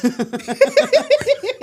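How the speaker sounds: high, loud burst of laughter; big high-pitched laugh; delighted laugh. Real, not acted. Laughing at a compliment on age.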